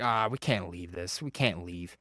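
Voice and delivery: dropped low and soft, murmuring in worry; softly fretting to sister beside the stroller, hushed and worried. Retold or imitated. Worried whisper over abandoned baby.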